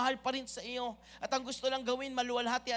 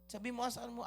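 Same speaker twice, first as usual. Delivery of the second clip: ordinary talk, nothing marked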